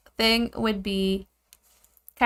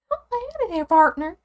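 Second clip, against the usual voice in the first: high, hammed-up Southern cowboy greeting; Southern accent; 8 semitones higher than usual. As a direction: play a cartoon Southern cowboy, pitched high. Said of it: "I loved that accent as a kid".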